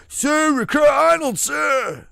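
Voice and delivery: high, loud recruit bark to a drill instructor; recruit shout. Retold or imitated. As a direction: sound off like a panicked boot camp recruit. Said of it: "looked just like Vincent D'Forino in Full Metal Jacket".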